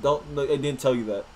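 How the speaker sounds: usual speaking voice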